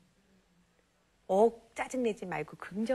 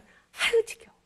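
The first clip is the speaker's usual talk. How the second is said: put-on much higher, louder exasperated child's whine; 13 semitones higher, 5 dB louder, slower than usual. Girl on another floor stop, whining louder. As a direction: let the boredom burst out. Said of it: "또 한 층 쓰면".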